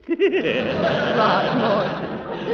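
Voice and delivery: a big pleased laugh at his own joke; hearty chuckle. Acted. Gildersleeve laughing at his joke about Eve's dress. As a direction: laugh loud at your own wisecrack.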